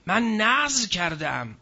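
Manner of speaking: usual speaking voice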